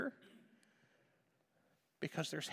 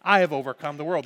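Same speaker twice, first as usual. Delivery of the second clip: I have overcome the world, said again much louder and faster; loud emphatic repeat; 10 dB louder, faster than usual. Shown as a demonstration. Preacher to the congregation, repeating Jesus' words with a loud triumphant punch.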